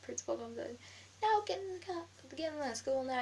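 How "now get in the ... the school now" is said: high, raised imitation of an order; retold principal's order. Principal ordering a pupil back inside, high mock yell.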